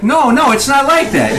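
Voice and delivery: hurried-to-reassure high protest; acted alarmed denial; backpedaling. A narrator's reading. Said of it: "trying to backpedal".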